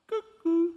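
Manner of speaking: high, piping two-note cuckoo call; cuckoo clock whistle. Retold or imitated. Preacher mimicking the shop's cuckoo clock, high whistled cuckoo. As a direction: imitate the clock's little bird, high and loud. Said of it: "a little whistle inside".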